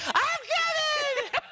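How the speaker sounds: very high, drawn-out mimicked holler; mock hallway call. Co-host mimics colleague in the hallway, high drawn-out call. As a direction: imitate someone calling out from afar, stretched and high. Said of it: "I heard Maddie in the hallway going".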